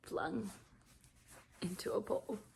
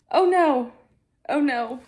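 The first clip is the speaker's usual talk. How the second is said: loud, higher "Oh no"; dismayed cry; 4 semitones higher, 9 dB louder than usual. Real, not acted. Reader hit by a grim reveal, crying out. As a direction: blurt it out in alarm.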